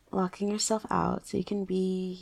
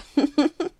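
bright teasing giggle; 7 semitones higher, 10 dB louder than usual. Acted. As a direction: giggle at your own joke. Laugh after tease.

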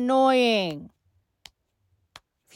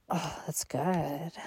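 soft slow; 5 semitones lower, 10 dB quieter than usual. Acted. Pleased, softening.

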